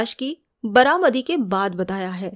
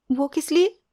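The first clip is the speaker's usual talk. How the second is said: innocent; 6 semitones higher than usual. A narrator's reading. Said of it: "वो बड़ी मासूमियत से बोली".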